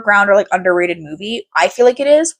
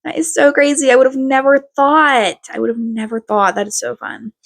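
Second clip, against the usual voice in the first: hammed-up wonder that tapers off; faked enthusiasm; 2 semitones higher than usual. Shown as a demonstration. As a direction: pretend to be blown away for a kid. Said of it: "You have to, like, fake it".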